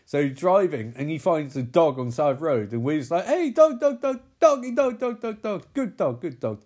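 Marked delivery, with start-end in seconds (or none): 3.2-6.6 high, eager dog-calling, easing down at the end. Retold or imitated. Man beckoning a roadside stray, high and eager. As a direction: coax a stray dog with excited repeats.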